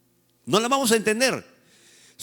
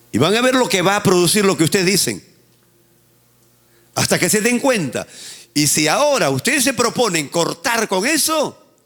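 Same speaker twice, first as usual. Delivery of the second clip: preaching at full force; 4 dB louder than usual. Real, not acted.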